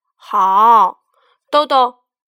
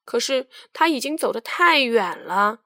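ordinary delivery, nothing marked

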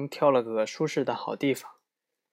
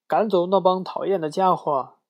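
higher, louder angry shout; 6 semitones higher, 6 dB louder than usual. Acted. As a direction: friends yelling at the moose. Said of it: "一起大声嚷". (更高更响的愤怒大喊)

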